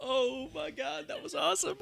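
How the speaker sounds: excited. Real, not acted. Reacting to the promo.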